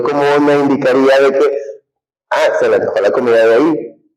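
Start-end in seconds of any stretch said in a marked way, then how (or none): none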